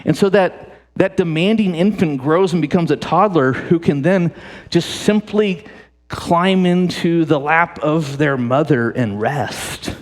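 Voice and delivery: ordinary talk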